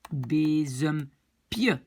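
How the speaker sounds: bezempieu drawn out in separate parts; slow, syllable by syllable. Shown as a demonstration. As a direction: sound out "bezempieu" very slowly for learners. Teacher builds the diminutive slowly. (bezempieu, lentement, syllabe par syllabe)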